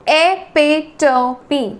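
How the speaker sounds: epitome slowly as e, pi, to, mi; four evenly separated beats in epitome; syllable by syllable. Shown as a demonstration. Teacher breaks word into syllables.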